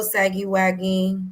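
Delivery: usual speaking voice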